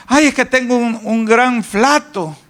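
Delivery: high, fretful complaining with sighing ays; mock hypochondriac moaning about his illness; whiny worried hypochondriac. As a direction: play a worrier sure he is dying. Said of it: "le dice el doctor".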